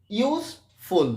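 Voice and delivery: Useful split into ইউজ, pause, ফুল; syllable by syllable. Shown as a demonstration. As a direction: say useful slowly with a clear break before ফুল. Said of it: "দেখো, উচ্চারণ করে বেরিয়ে যাবে". (Useful ভেঙে ভেঙে, মাঝে থেমে)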